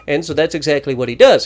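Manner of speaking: ordinary talk, nothing marked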